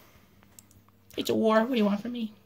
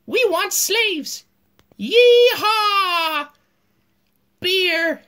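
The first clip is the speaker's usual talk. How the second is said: slow hammed-up yokel ending in a yelled yeehaw; mock Southern cowboy; 4 semitones higher, 5 dB louder, much slower than usual. Teacher voicing the South as a whooping cowboy, slow and hammed-up. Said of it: "And the South was like".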